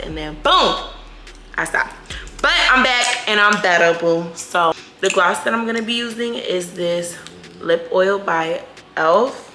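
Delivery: ordinary talk, nothing marked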